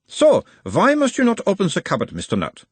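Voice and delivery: put-on high, probing analyst in Uberwald accent; Uberwald-accented therapist, pitched well up; mild Uberwald accent. A narrator's reading. Nutt as analyst asks about his mother, Uberwald accent. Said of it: "asked in a mild Uberwaldian accent".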